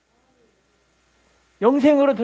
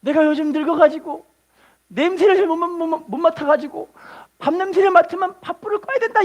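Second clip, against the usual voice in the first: mother-in-law taking blame; 6 semitones higher than usual. Retold or imitated. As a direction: insist it's her fault. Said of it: "이렇게 이야기를 하는 거예요".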